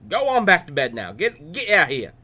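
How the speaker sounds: put-on grumpy old priest sending boy away; old-timey grumpy old man. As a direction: shoo the boy back to bed. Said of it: "That's how they sounded back then".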